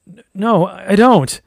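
loud, high stammered denial; stammer, then cry out against it; pained resistance. A narrator's reading. Woman fighting a demon's pull denies her own wish, in pained protest. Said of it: "She winced".

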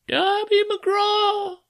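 very high, slow, drawn-out phantom wail; ghostly wail. Acted. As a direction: wail the name like a distant ghost. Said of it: "it wailed".

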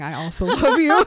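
a loud, hammed-up "I also love you"; teasing impression of a swooning heroine; mock loving Bella. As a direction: overplay the line for a laugh. Mocking Bella's confession.

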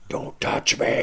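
voiceless demonic rasp; mock horror-film possession voice; possessed wife. Wife spinning her head halfway round at her husband, mock demonic rasp.

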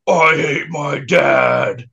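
slow, drawn-out unhinged line, lightly voiced; a hint of a crazed guy, said slowly.

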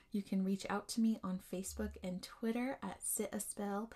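ordinary delivery

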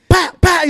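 high, loud pat pat of a BB gun; mimicking BB gun shots at the rat; imitated gun shots. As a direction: pop out the shots like a toy gun. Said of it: "you just hear that shit".

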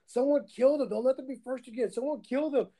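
high-pitched imitation of rattled opponents; mock frantic players. Gamer imitating opponents begging for someone to kill him, mock frantic.

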